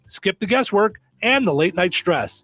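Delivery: upbeat local radio ad voice; radio ad read. Acted. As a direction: sell it like a hometown radio spot. Reads a radio ad.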